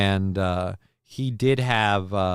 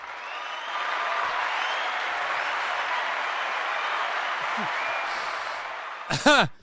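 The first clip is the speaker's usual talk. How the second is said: long laugh; 9 semitones higher, 8 dB quieter than usual. Real, not acted. Streamer cracks up at clip.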